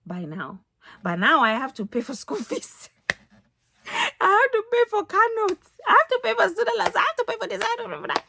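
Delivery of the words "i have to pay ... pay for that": high, loud, exasperated whining; whiny complaining voice. Retold or imitated. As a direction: complain like you're fed up. Listing bills in a complaining voice.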